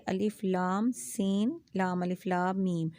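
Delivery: name each letter of السلام and علیکم slowly and clearly; slow letter spelling. Shown as a demonstration. Teacher spelling out the greeting's letters for students, slowly. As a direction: spell out الف لام سین and لام الف لام میم letter by letter, very slowly. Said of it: "پر focus کیجئے".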